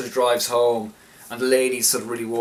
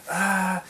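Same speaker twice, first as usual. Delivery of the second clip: high-pitched mild imitation scream; short, high retold shriek; mock scream; 9 semitones higher than usual. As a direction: act out a scream without the volume. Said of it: "turns around and just screams".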